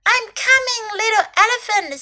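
high-pitched rescuer's call; crying out. A narrator's reading. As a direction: call out high as you rush to help. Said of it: "Snake cried".

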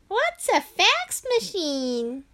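put-on high, slow puzzled kid. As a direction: ask like a clueless kid.

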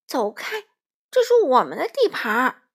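loud, indignant order to leave; angry squawking. A narrator's reading. Crow ordering the wolf off their castle, angry squawk. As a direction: defend your turf loudly. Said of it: "花袜子气得呱呱叫".

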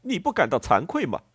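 acted indignation; indignant reproach. A narrator's reading.

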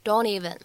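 "don't even" run together as one word; "don't even" with no gap between the words; linked DON'T EVEN. Shown as a demonstration. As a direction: link the n-t of "don't" straight into "even". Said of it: "去做一个连读".

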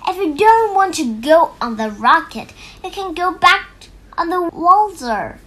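calling out. A narrator's reading. Tom calls after running Dad.

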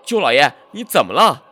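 a loud, terrified shout; frightened shout. A narrator's reading. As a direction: yell out in fear. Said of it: "在大声喊道". (恐惧地大喊)

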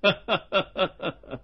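amused chuckle; lightly voiced chuckle; magistrate laughs. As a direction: laugh at the misunderstanding. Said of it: "乐了".

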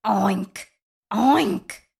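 two separate grunted oinks; pig oink. A narrator's reading. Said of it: "grunted the pig".

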